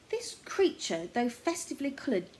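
ordinary delivery

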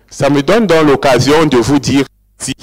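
ordinary talk, nothing marked

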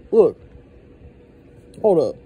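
ordinary talk, nothing marked

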